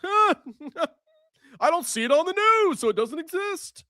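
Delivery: very high, sneering condescending voice; mock them as smug and oblivious; dismissive mock leftist.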